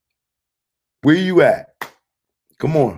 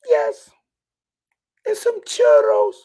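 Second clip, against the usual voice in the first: very high squeaky granny voice; squeaky old lady voice. Acted. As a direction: pinch it up into a tiny granny squeak. Old lady customer voiced in story.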